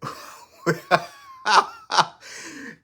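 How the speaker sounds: high, loud burst of laughter; real, helpless laughter; loud cackling laugh. Real, not acted. Laughs at viewer comments.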